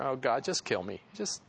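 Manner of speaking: a hint of a sulky death wish; understated gloomy Jonah; depressed Jonah. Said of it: "He's, he's totally depressed here".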